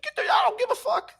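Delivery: very high-pitched retold screech; mock screaming. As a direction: imitate a belligerent passenger screaming. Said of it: "She's screaming?".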